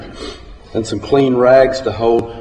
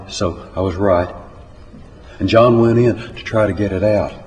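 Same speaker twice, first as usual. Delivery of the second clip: plain reading voice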